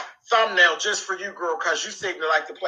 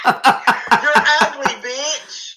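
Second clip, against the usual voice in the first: laughing taunt; 7 semitones higher, 7 dB louder, much slower than usual. Real, not acted. Streamer laughing as she insults her rival. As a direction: laugh through a slow, drawn-out "you're ugly".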